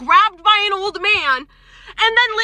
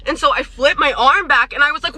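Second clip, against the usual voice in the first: ordinary talk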